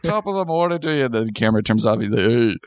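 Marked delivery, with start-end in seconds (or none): 0.0-1.0 high, put-on Irish brogue; fake Irish accent. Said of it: "he has to fake that Irish accent". 2.3-2.5 slow, slurred mock tough-guy voice; Sylvester Stallone. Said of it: "He sounds like, uh, Sylvester Stallone".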